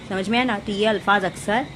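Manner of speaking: ordinary talk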